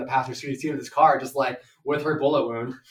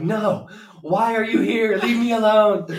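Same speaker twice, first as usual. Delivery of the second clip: higher, louder, slow disbelieving whine; mock horrified yell; 8 semitones higher, 9 dB louder, slower than usual. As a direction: beg him to go away, hammed-up. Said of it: "I'm like".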